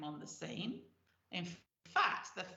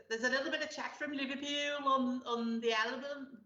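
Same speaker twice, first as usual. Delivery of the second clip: hammed-up Scouse, raised pitch; bad Liverpool accent; 4 semitones higher than usual. Host mentions the album's chat from Liverpool, bad Liverpool accent. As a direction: attempt a Liverpool accent and overdo it. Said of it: "That's a terrible Liverpool accent".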